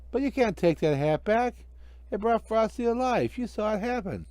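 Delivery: put-on high child's protest; a kid insisting it was magic; pleading child.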